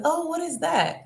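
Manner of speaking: high, curious question; mock innocent tourist.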